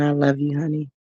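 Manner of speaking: soft, tender words to his partner. Real, not acted. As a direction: say it softly, sincere. Message to his woman.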